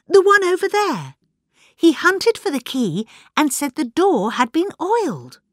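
high, loud, excited blurting; excited cry. A narrator's reading. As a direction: blurt out news at a high pitch. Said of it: "cried Miss Bunner".

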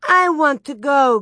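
put-on loud, high toddler demand; demanding child. A narrator's reading. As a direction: insist like a spoiled youngest child. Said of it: "Grace demanded".

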